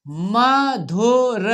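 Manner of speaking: Madhur découpé lentement, syllabe par syllabe; lecture syllabée. Shown as a demonstration. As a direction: fais durer chaque syllabe de Madhur. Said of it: "हमारे साथ पढ़ना है आपको".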